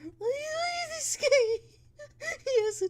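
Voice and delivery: very high squeal breaking into laughter; high, loud laughing cry over a tuba goblin; delighted squeal. Real, not acted. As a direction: shriek with laughing joy.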